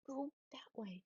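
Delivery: soft whispered command to the dog; whispering. Real, not acted. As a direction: breathe it out without voice. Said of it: "I don't know why I'm whispering".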